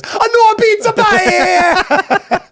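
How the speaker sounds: high, drawn-out cartoon Italian; Italian accent. Italian translator announcing restaurant closures, over-the-top Italian accent. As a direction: wail it like a hammed-up Italian. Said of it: "then the Italian guy goes".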